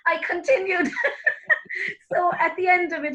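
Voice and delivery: high, amused telling broken by laughter; real laughing. Real, not acted. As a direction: laugh through the punchline of your own story. Laughing through her own exam story.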